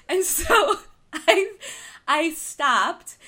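slow, high laughing words. Real, not acted. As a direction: still laughing through it. Laughing at her story.

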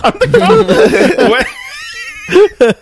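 very high squeaky laughing voice; laughing mock voice. Friend mocks a line in a squeaky laughing voice.

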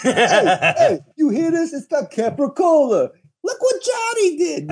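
very high, hammed-up Brooklyn Italian; mock a Brooklyn Italian kid crowing excitedly; Brooklyn Italian accent. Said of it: "like, Brooklyn, you know, Italian scene".

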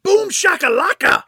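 high, very loud mock whoop; hammed-up triumphant shout; screamed out. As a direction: yell it like a wild man blowing things up. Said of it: "and he screams out".

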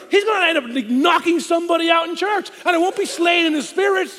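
high and loud frantic rant; comic panic. Retold or imitated. Son panicking about his biker dad in church, high and loud. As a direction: build into a shouting, laughing panic.

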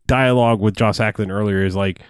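normal talking voice

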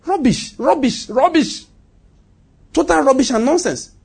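high-pitched, emphatic rejection; scornful dismissal. Real, not acted. Preacher dismissing bad financial teaching, scornful. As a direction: throw each word out as worthless, pitched high.

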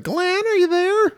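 very high, slow falsetto call; mock girl calling. Girl outside calls for her hidden boyfriend, mock high calling. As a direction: call out slowly in a put-on falsetto. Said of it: "and she's like".

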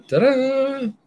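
high, sung ta-da fanfare. Pretend. Host launches story time with a fanfare. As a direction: sing it like a trumpet flourish.

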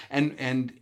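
normal talking voice

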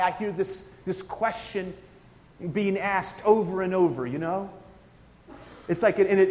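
ordinary talk

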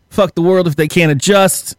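ordinary delivery

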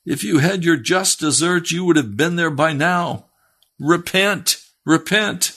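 high, forceful retold rebuke; stern exhortation. Preacher answering the wailing sinner, acted stern exhortation. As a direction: drive the command home at high pitch.

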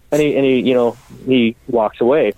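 ordinary talk, nothing marked

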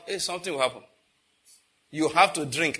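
normal talking voice

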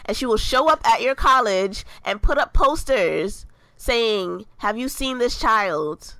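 loud, hammed-up warning to the kids; mock scolding. Host warning kids to call their mother, loud mock scolding. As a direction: build to a loud mock lecture, slowing at the end.

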